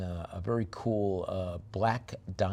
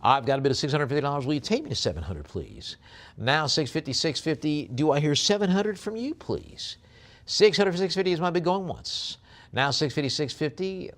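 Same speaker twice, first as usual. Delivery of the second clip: brisk auctioneer chant, a bit louder; auctioneer bid calling; 5 semitones higher, 4 dB louder than usual. Acted. Auctioneer calling bids to online bidders, brisk. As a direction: rattle off the bids to close the sale.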